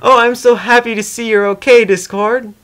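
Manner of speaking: a pony overjoyed to see him safe; light, teary delight; joyful relief. A narrator's reading. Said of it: "let out little laughs of joy".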